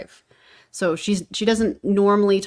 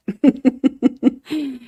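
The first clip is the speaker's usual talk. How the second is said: laughing; 7 semitones higher, 7 dB louder than usual. Real, not acted. Laughing at her own ramble.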